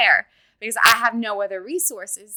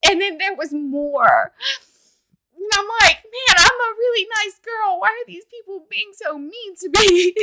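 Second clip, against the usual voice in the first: tearful hurt; 8 semitones higher, 6 dB louder than usual. Real, not acted. Tearful recalling of mistreatment.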